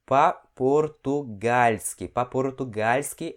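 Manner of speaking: very slow, each syllable drawn out; slow pronunciation. Shown as a demonstration. Teacher repeats the word for students, very slow and careful. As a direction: stretch По-португальски out slowly for the learner. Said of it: "deixa eu pronunciar isso aqui mais uma vez".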